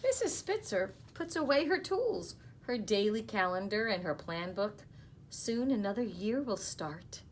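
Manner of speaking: storybook reading voice, lively; gentle read-aloud to young listeners; reading to children. Acted. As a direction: read it to the class like a picture book. Teacher reads picture book aloud.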